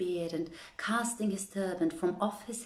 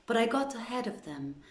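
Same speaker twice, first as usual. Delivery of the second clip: plain reading voice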